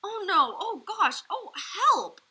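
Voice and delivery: high, loud panicked cries; disgusted cries. A narrator's reading. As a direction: cry out in revulsion. Sophie tastes vile snozzcumber.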